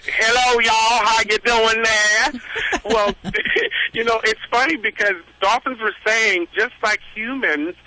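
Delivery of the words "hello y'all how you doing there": slow, drawn-out Southern greeting; Southern accent. Retold or imitated. Speaker imitating Southerners to show dolphin accents, slow Southern drawl. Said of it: "Like people from the South".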